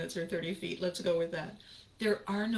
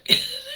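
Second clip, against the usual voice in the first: high, loud burst of laughter; cracking up mid-sentence; loud squeal of laughter; 9 dB louder than usual. Real, not acted.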